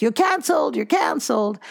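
imitating a triggered person cutting someone off; mock dismissal. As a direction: snap it out like a dismissive verdict. Mimicking people who cancel others.

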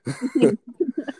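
high, squeaky laugh. Real, not acted. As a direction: giggle up high. Laughs before next question.

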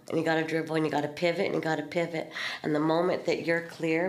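ordinary delivery

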